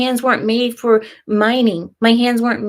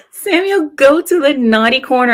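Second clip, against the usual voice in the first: high, loud pretend telling-off; mock scolding; 5 semitones higher, 4 dB louder than usual. Streamer playfully scolds Samuel for his comment, mock stern. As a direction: send him to the corner like a naughty child.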